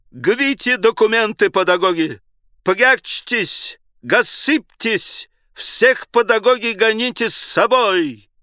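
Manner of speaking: high, loud, slow rolling burr on every r; burred commanding howl. A narrator's reading. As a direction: bellow orders with a guttural French r. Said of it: "он взвыл команду необычным, неслыханным картавым голосом". (картавый командный вой)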